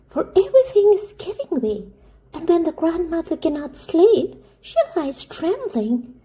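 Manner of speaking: put-on very high, urgent little girl voice; pleading child Heidi.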